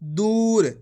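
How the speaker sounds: दूर with a drawn-out ऊ; long u demo. Shown as a demonstration. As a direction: lengthen the ऊ in दूर. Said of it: "कैसे पढ़ेंगे".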